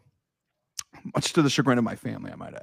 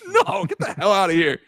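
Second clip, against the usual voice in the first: mock disbelief; 8 semitones higher than usual. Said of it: "I was like".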